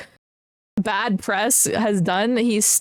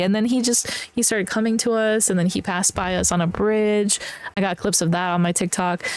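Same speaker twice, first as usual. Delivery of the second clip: normal talking voice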